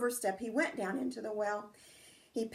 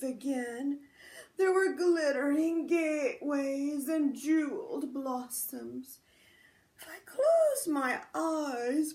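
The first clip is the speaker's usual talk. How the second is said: a lightly voiced old man, slow and dreamy; 3 semitones higher, 3 dB louder, slower than usual. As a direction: drift off slowly, a drowsy old man. Sleepy old man disturbed.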